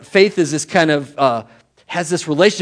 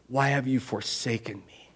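forsaken lament; 3 semitones lower, 8 dB quieter than usual. Acted. Acts Jesus' words from the cross.

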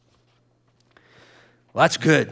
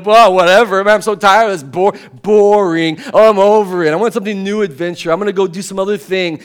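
a louder, bored whatever voice; loud mock boredom; 3 semitones higher, 8 dB louder than usual. A churchgoer loudly blowing off God in mock boredom. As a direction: blow it off like a bored teen. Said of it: "We kind of go".

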